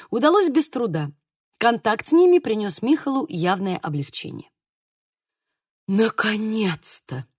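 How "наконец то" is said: slow, drawn-out sigh on the words; agitated sigh of relief. A narrator's reading. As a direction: sigh it out slowly, stirred up. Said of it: "взволнованно вздохнул он". (взволнованно, со вздохом)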